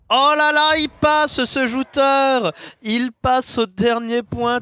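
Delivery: very loud, high, drawn-out play-by-play; excited shout. Real, not acted. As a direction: shout the decisive play, holding the words. Caster shouts the decisive moment.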